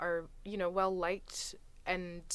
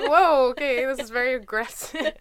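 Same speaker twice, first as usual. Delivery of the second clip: loud, high startled whoa; retold shock, much louder and higher; 6 semitones higher, 15 dB louder than usual. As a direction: recoil at the aggression, half laughing. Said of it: "and I was like".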